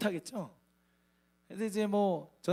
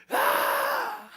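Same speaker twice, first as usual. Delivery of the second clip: very high loud wail; long high anguished shout; anguished cry; 6 dB louder than usual. Acted. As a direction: cry out to heaven in despair. Crying out like David.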